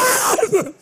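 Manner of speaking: a high, loud startled shriek with a laugh; shocked scream. Acted. He looks at the girl beside him and shrieks in mock horror. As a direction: shriek as if you saw a ghost. Seeing the girl's face.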